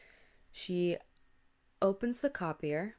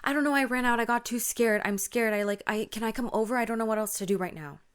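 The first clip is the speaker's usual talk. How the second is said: higher, louder acted panic, easing off; freaking out; 4 semitones higher, 5 dB louder, faster than usual. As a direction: babble like a scared friend. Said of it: "she's freaking out".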